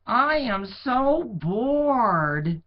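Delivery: put-on high, slow, whiny bored boy; bored shepherd boy. A narrator's reading. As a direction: drag it out like a bored kid. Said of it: "he thought".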